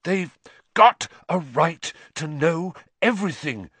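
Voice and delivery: high, strained breathless plea; panting. A narrator's reading. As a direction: push it out between breaths. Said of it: "Lupin panted".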